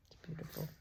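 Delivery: soft, hushed and unhurried; whisper. Real, not acted. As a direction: breathe it out in a whisper. Savouring quiet at the end.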